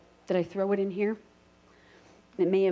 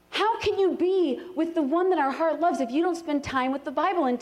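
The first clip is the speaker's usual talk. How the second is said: fervent preaching; 10 semitones higher than usual. Acted. Pastor urging the congregation on, fervent preaching. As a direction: preach it out with passion.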